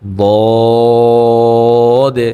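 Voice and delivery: বদে held long and thick from the throat; heavy throaty Dad. Shown as a demonstration. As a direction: fill the mouth and draw বদে out. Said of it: "মুখ ভরে তারপর আসবে". (গলা থেকে মোটা করে বদে)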